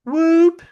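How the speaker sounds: high loud whoop imitation; high hooting whoop; imitated whoop call. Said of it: "it was just like this".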